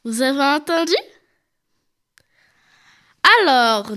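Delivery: normal talking voice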